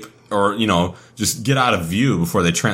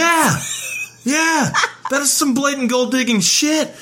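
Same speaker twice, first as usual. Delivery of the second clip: outraged yell; 14 semitones higher than usual. Real, not acted. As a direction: shout it out, scandalized. Outraged agreement about gold digging.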